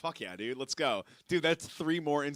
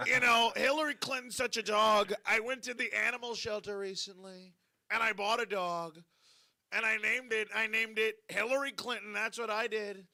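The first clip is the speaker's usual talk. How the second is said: higher-pitched mock presidential impression; Donald Trump; 3 semitones higher than usual. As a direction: do a comic politician impression, pitched up. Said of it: "At least you let me do the Trump impression".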